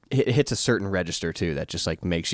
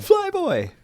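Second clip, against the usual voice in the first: grand announcement; 4 dB louder than usual. Pretend. Announcing the character's grand title.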